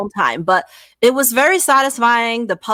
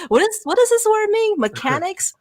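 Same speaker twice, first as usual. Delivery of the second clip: high, puzzled put-on voice; hammed-up bewildered question; mock confusion; 13 semitones higher than usual. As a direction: play dumb over the word. Mock confusion over rule book word.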